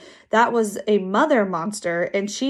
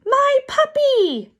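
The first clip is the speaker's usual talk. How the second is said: very high, slow, loud wail; drawn-out high-pitched kid's scream; child's howl; 5 dB louder, slower than usual. A narrator's reading. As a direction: howl it out like a frantic small child. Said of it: "Aki howled".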